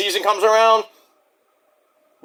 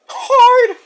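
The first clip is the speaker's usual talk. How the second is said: "Hard!" very high and loud; comic falsetto outburst; high mock squeal; 5 dB louder than usual. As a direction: yelp "Hard!" in a silly high voice. Mock echo of own word.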